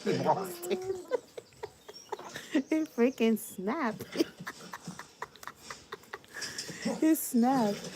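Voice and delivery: high laughing retelling. Real, not acted. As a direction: say it through laughter. Laughing while telling the blowup.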